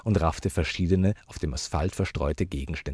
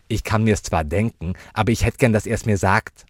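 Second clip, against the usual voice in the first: put-on loud child's voice, rising in pitch; insistent child; 4 semitones higher, 7 dB louder than usual. Child wanting her uncle to say it, loud and insistent. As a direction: press loudly for the answer.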